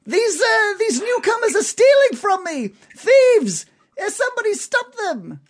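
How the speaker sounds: high, loud cries of thief; screaming shopkeeper. Acted. Shopkeeper screaming after the departing newcomers, high and loud. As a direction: yell for help as if robbed. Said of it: "he screams".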